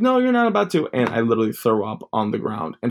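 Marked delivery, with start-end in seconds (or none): 0.0-0.9 high, pushy uncle voice. Retold or imitated. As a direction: bark it like a drill sergeant uncle. Uncle dismissing him.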